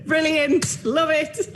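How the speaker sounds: bright, high burst of delight after a joke; delighted exclamation. Real, not acted. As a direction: cheer the punchline like you loved it. Host delighted by punchline.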